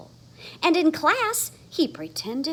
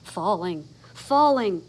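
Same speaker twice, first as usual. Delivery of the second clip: ordinary delivery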